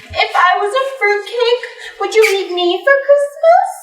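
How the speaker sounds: very high, mock-coquettish voice. Vixen mimics tipsy Mrs. Claus propositioning the bucks, high mock flirting.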